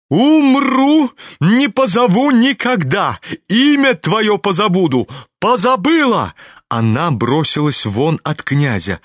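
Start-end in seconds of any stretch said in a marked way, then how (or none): 0.1-6.3 an offended matron's shrill-pitched outburst of rage; furious shout. A narrator's reading. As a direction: hurl the vow at him, nearly screaming. Furious woman vows never to call.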